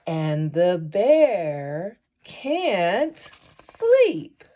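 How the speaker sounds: lively, sing-song bedtime story voice; animated read-aloud with drawn-out refrains; reading to children. Acted. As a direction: read it like a cozy bedtime picture book. Reading picture book aloud.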